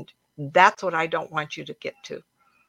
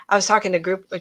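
ordinary talk, nothing marked

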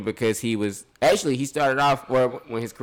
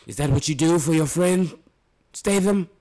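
hint of British accent; 6 semitones higher than usual. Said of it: "Let me try to do Idris".

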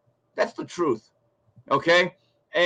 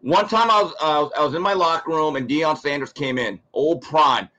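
normal talking voice

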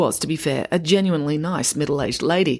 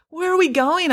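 high puzzled question; 13 semitones higher than usual. A narrator's reading. Tom dragged along.

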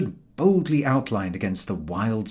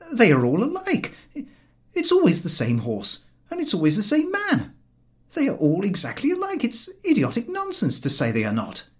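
irritated George; 12 semitones higher than usual. A narrator's reading. George arguing with Harris, insistent and irritated. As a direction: hammer each point home. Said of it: "He appeared to be angry with Harris".

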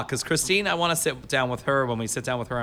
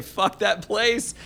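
drawn-out dismissal; 7 semitones higher, much slower than usual. Retold or imitated. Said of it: "I would always want to say".

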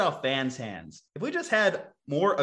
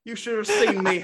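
put-on old man voice; 8 semitones higher, 4 dB louder than usual. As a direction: reminisce like a cranky old-timer. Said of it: "old man impersonation".

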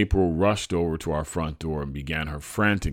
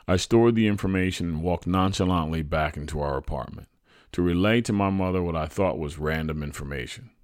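usual speaking voice

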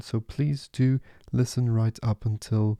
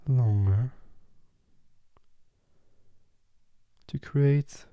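guided meditation. Acted.

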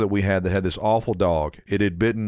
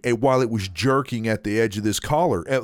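ordinary delivery

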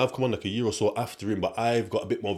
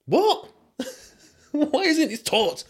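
high-pitched disbelief breaking into laughter; mock incredulous; much slower than usual. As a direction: squeal it in mock outrage, laughing. Mock outrage at missing school lessons.

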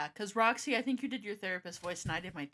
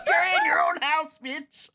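playful shout; 8 semitones higher, 11 dB louder, slower than usual. Real, not acted. Teasing shout at friend.

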